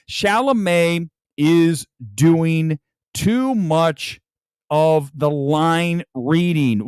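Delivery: very slow, word by word; overdone line reading. Shown as a demonstration. Reviewer showing how Chalamet overdoes lines, slow and laboured. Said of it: "he is literally reading it".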